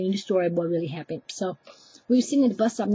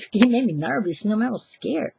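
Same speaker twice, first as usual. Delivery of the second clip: ordinary talk, nothing marked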